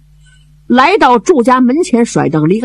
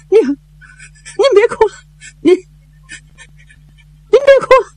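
put-on high, slow girl's voice choking down tears; a daughter swallowing her sobs to comfort her mother; girl holding back tears; 14 semitones higher, slower than usual. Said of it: "眼泪往肚子里咽".

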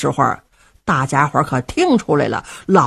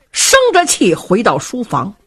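ordinary delivery

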